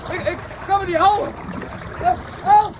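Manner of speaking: breaking into a scared yell; panicked cry for help. Acted. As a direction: shout help as the water takes you. Swept away by current.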